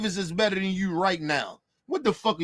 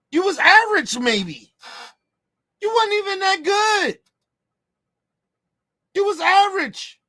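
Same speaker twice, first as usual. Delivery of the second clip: angry shout; 11 semitones higher, 3 dB louder than usual. Real, not acted. Angry rant at a boxer.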